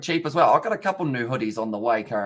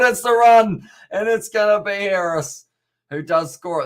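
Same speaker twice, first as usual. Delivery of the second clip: loud, higher burst as the try goes in; excited try call; 7 semitones higher, 7 dB louder than usual. Real, not acted. Streamer calling a try to his chat, excited loud shout. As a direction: shout the break as it happens. Try being scored live.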